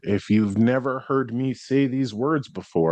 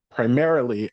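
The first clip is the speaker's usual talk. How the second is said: normal talking voice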